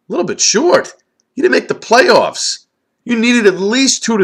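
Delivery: high, scornful disbelief; incredulous. Real, not acted. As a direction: throw the words back in disbelief. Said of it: "incredulous echo of the owner".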